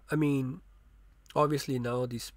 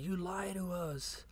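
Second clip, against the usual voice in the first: put-on hurt kids; 7 semitones higher, 3 dB quieter than usual. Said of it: "They're like".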